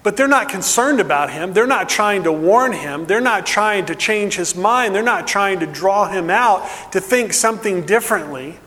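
normal talking voice

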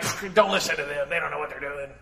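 scoffing, waving off the crowd; dismissive leader. Retold or imitated. Leaders scoffing at the ignorant masses, dismissively. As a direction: brush them off with a lazy pfft. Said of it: "Leaders often look at ... be like".

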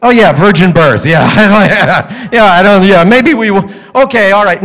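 grudging, muttered backing-down with a laugh; exasperated parents. Acted. Parents remembering the virgin birth, grudgingly exasperated. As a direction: give in while wanting to strangle him. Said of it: "ready to strangle him. They go".

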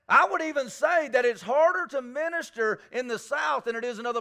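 fervent Southern preacher calling out; preaching at full force. Acted. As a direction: drive it home like a revival preacher.

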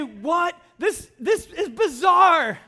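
slow, drawn-out bewildered voice; baffled reader. Retold or imitated. Pastor imitating a confused Bible reader, slow baffled voice. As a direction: sound totally baffled by the sign. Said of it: "you read it and you say".

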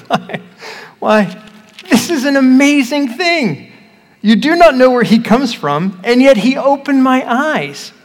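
high, loud, astonished protest; the healed man can't believe they don't see it; amazed exclamation. Acted. Healed man marvels to the Pharisees, high and astonished.

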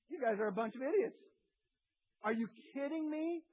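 can't believe what they're asking; exasperated Jesus. Retold or imitated. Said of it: "And Jesus goes".

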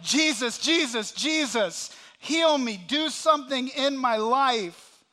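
loud, high, urgent acted plea; repeated cries building into a drawn-out appeal; crying out. Blind beggar begging Jesus to heal him, crying out. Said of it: "of, of crying out".

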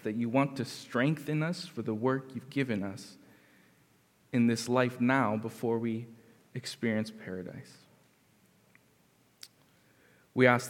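soft, reverent prayer voice. Acted. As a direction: pray quietly to God. Opening prayer.

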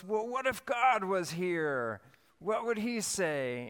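put-on a kid stopping herself to ask a question, slowly; imitating daughter wondering. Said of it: "And then she goes".

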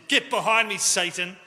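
loud, sharp rebuke; Jesus rebuking Peter before everyone; firm commanding shout; stern rebuke. Retold or imitated.

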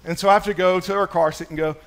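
ordinary delivery

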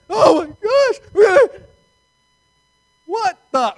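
high, loud, slow panicked shriek. Retold or imitated. Preacher acting terrified disciples, high loud shriek. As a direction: shriek it like you're sinking.

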